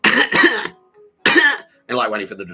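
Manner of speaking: loud sing-song teasing chant; mocking taunt. Winding up the listeners on the bugged phone, loud mocking chant. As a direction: chant it at them to wind them up. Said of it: "the dog shit fan thing where I was like".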